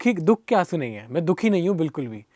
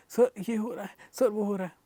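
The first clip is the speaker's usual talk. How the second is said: retold tearful pleading; 4 semitones higher, 5 dB quieter than usual. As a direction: replay yourself sobbing out the story to your guru. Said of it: "और मैं रो रो के".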